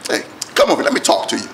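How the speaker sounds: quiet beckoning aside; hushed call. Retold or imitated. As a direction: whisper him over, low and private. Prophet calls him over.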